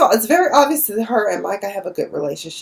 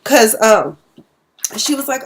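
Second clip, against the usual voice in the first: ordinary talk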